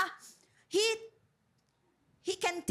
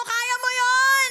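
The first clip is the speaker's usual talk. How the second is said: "Kaya mo yun!" shouted higher and louder, slow; shouted declaration; 6 semitones higher, 9 dB louder, slower than usual. Shown as a demonstration. Preacher leads the church in shouting loudly. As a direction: belt out "Kaya mo yun!" to the crowd. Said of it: "Sabi mo sa atin mo".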